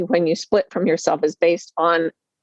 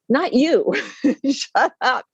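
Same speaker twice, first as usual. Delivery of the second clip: higher-pitched retort, lightly annoyed; mock irritation; 6 semitones higher, slower than usual. Waving off the nurse to hear the voice, mock irritated. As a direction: brush off an interruption in mock impatience.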